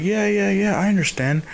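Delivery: high, hasty going-along; acted over-understanding yeses; eager agreement. Too eagerly agreeing with her.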